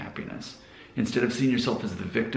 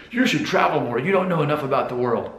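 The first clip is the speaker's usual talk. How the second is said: higher-pitched mock commenter; imitated critical commenter; 5 semitones higher than usual. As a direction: mimic the commenters' advice in a raised voice. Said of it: "people love to leave this comment and say".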